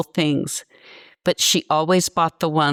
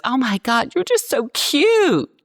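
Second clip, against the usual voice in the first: mocking a leering interviewer; 9 semitones higher than usual. Interviewer gushing at a young woman, high mock voice. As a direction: squeal it like a patronizing man gushing. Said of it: "the dude looks at me and he goes".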